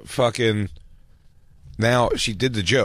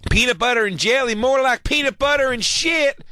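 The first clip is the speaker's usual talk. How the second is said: hack comic punchline; 12 semitones higher than usual. Retold or imitated. Hack comic landing his punchline, hammed-up and high. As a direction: sell a lame joke with a goofy high voice. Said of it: "It's like".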